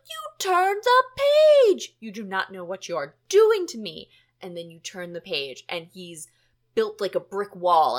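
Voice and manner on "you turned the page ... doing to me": hammed-up shriek of a betrayed Muppet; Grover outraged. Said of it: "Grover's like".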